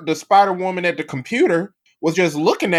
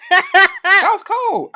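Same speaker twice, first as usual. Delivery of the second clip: laughing; 14 semitones higher, 5 dB louder, much slower than usual. Real, not acted. Laughing at an R-rolling Miles.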